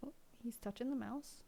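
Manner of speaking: hushed, breathy aside; whisper. Real, not acted. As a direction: whisper so the cat won't hear.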